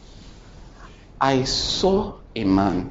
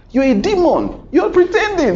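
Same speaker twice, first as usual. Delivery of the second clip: high, loud accusing cry; shrill-pitched scorn thrown at him; mock accusation; 6 dB louder than usual. Preacher voicing people who call him a demon, high loud accusing.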